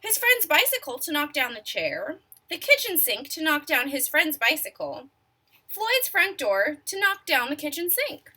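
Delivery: ordinary delivery, nothing marked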